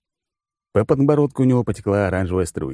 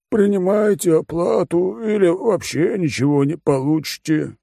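high, slow, lazy condescending voice; bored rich glutton explaining terms; smug fat oligarch; 9 semitones higher than usual. Acted.

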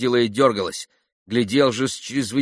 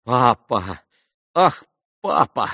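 pained daughter; 4 dB louder, slower than usual. A narrator's reading. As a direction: plead with father in embarrassed distress. Daughter ashamed of her father.

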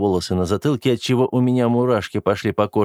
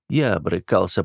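ordinary delivery, nothing marked

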